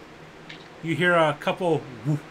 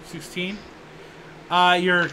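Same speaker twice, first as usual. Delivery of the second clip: usual speaking voice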